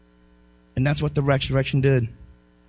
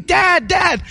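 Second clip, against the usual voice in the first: put-on higher, louder cry of a struggling boy; son calling for dad; 13 semitones higher, 4 dB louder than usual. Retelling his drowning son's cry, mock panicked call. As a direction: call out like a child in the water. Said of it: "and he's screaming".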